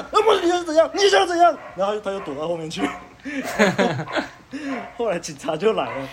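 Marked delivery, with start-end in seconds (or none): 0.1-1.6 very high, louder laughing challenge; retold laughing challenge, very high; challenging yell. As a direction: square up and yell at him. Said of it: "然后就往前站".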